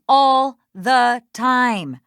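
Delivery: ALL THE TIME slowed right down, each word punched loud; slow, loud stress on every word of "all the time"; emphatic exasperation. Acted. Defensive answer under questioning.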